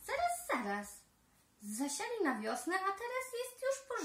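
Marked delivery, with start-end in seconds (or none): 0.1-1.0 sudden realization. A narrator's reading. Sudden realization mid-song.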